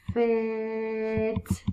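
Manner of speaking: "Fit" with long f and long vowel; sounded out. Shown as a demonstration. Teacher sounds out a word for new readers, "Fit" drawn out. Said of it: "Sound it out".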